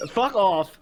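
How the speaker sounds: high, loud cracking voice. Real, not acted. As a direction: voice breaks on the outburst. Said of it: "I know my voice cracked".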